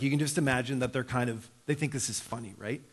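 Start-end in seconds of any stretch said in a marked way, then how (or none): none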